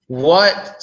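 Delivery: normal talking voice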